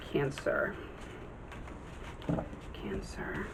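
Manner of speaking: soft whispered muttering; whispering to herself. Real, not acted. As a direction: breathe the word under your breath. Muttering while sorting cards.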